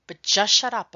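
sharp order. Acted. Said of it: "Frosty interrupts me".

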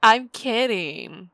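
loud high laugh into slow teasing; laughing tease. Acted. Teasing the friend about the movie, laughing. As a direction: burst out laughing then tease.

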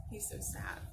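hushed sympathetic whisper. Acted. Teacher to the children about the seal, whispered. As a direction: breathe it softly, feeling for him.

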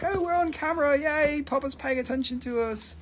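high-pitched thrilled pet voice; playful high doggy voice; voiced excited dogs. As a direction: speak for the delighted dogs. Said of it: "They're like".

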